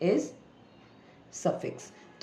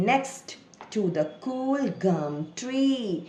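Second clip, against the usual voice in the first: drawn-out words in a model reading; slow word-by-word reading; 3 semitones higher, much slower than usual. Shown as a demonstration. Teacher reads along with a child, slow and drawn out. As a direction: read slowly, stretching each word.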